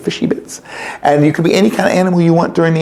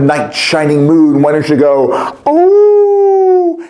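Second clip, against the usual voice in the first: mock wonder; much slower than usual. A narrator's reading. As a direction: sing out an amazed oh. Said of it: "He shouted".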